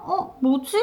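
soft, baffled muttered question; clueless puzzlement. Retold or imitated. Confused part-timer lets the touching pass, softly clueless. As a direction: wonder quietly, like a clueless fool. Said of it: "그냥 바보처럼".

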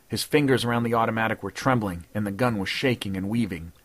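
ordinary delivery

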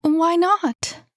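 high, loud, dismayed question; disappointed protest. A narrator's reading. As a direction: protest the letdown, pitched high. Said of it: "There was no hiding her disappointment".

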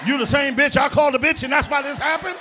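put-on a baffled boyfriend's outraged question; dumbfounded man. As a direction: sputter in disbelief.

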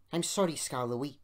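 usual speaking voice

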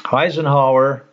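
normal talking voice

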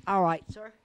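ordinary talk, nothing marked

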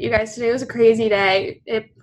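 usual speaking voice

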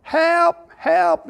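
a man dangling from a cliff, hollering for help. Retold or imitated. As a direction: yell up the cliff for help, hammed up. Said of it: "he was hollering".

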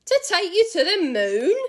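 Mister Twit exclaiming. A narrator's reading. Mister Twit answering his wife, loud mock shock. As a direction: exclaim it in fake horror. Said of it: "exclaimed Mister Twit".

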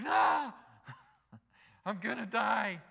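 hammed-up high, loud yelp of terror; mock panic. Preacher mimicking Gideon's "oh no" moment, mock panic. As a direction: play a comic panicked outburst.

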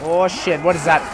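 ordinary talk

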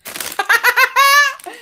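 high laugh. Real, not acted. Reacting to Kim's comment, high delighted laugh. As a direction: burst into a high, loud laugh.

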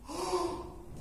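sharp high gasp of fright; frightened gasp. Acted. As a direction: gasp like a startled guard. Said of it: "they were very afraid".

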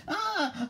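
high shriek of fright; frightened scream. Acted. Woman sees it on the floor, frightened scream. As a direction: shriek and recoil.

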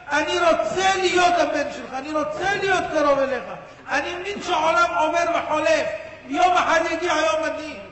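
impassioned, rising plea to God; penitent prayer. Acted. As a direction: pour it out like a returning son. Voices a penitent praying to God. (תפילת חוזר בתשובה בהתלהבות)

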